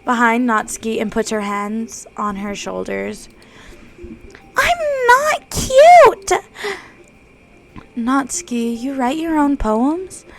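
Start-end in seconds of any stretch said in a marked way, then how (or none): 4.6-6.3 very high, loud, slow protest; flustered girl denying she's cute; Natsuki's voice. Acted. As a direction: stamp it out indignantly.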